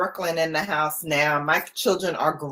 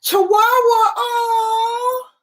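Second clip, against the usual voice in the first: much higher and louder, gushing; delighted squeal; 5 dB louder, much slower than usual. Real, not acted. Host to a viewer who has a Chihuahua, squealing with delight. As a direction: coo over it like you just saw a puppy.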